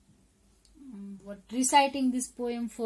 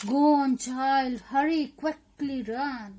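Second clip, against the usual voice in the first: loud, raised, stretched-out urging; urgent command; 4 semitones higher, 7 dB louder, much slower than usual. Acted. Poem character sending the child running, urgent command. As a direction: call out to send someone off.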